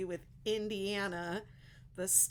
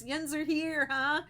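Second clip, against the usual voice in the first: a light, higher put-on Pittsburgh yinzer twang; mock Pittsburgh accent; 7 semitones higher, 5 dB louder than usual. Greeting Pittsburgh viewers, a playful mock Pittsburgh accent. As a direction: tease the crowd in a hammed-up Pittsburgh accent. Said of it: "That's a Pittsburgh thing".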